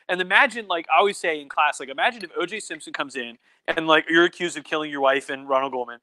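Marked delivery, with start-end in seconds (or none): none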